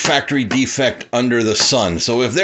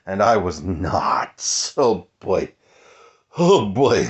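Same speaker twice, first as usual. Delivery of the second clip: normal talking voice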